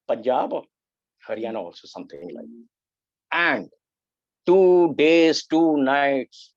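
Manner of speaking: ordinary talk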